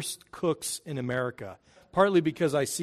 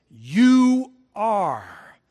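"You are" hit hard and loud; emphatic "You are"; 4 semitones higher, 9 dB louder than usual. Shown as a demonstration. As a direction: punch "You are" like a declaration. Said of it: "And it's emphatic".

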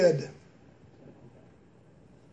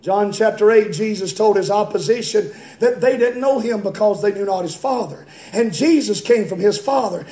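usual speaking voice

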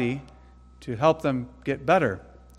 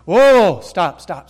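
pleading outcry; 4 semitones higher, 11 dB louder, much slower than usual. Pretend. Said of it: "you don't say to the nurses".

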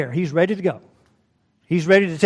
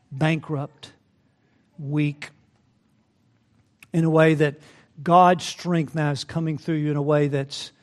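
usual speaking voice

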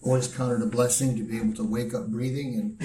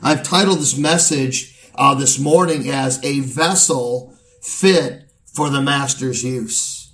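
steady pulpit preaching to the congregation; 3 semitones higher, 8 dB louder than usual. Acted. Pastor charging the church at an elder's ordination, in steady preaching voice. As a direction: deliver it as a sermon from the pulpit.

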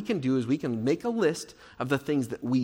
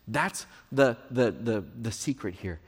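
ordinary delivery

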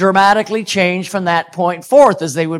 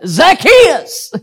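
high, loud call up into a tree; playful shout; 10 semitones higher, 4 dB louder than usual. Retold or imitated. Preacher calling up to a boy in a tree, playful shout. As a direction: call out the name teasingly, big and high. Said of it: "And so I said".

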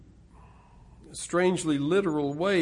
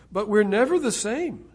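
higher and louder preaching; rising preaching; 7 semitones higher, 8 dB louder than usual. Real, not acted. Preacher rising in fervour toward the close. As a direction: lift it again toward the close.

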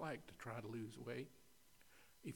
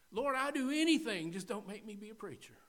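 louder and higher pleading, then fast; retold plea to God, higher and louder, rushing the end; pleading cry to God; 10 semitones higher, 11 dB louder than usual. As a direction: beg the Lord not to make you preach. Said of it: "how hard I fought him".

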